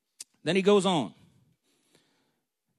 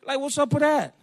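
higher, aggrieved mock protest; protesting younger brother; 4 semitones higher than usual. David complaining to his oldest brother, aggrieved protest. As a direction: complain in a raised pitch. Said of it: "I mean, you know, he's like".

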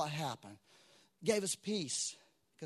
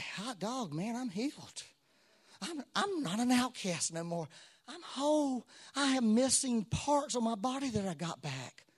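higher-pitched, mock-amazed joy; imagined elated gratitude; 6 semitones higher than usual. Healed leper rejoicing that he's whole, acted elation. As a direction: play a healed man marvelling at himself. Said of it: "hearts were full of gratitude".

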